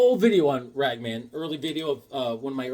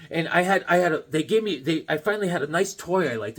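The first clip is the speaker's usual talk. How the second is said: ordinary delivery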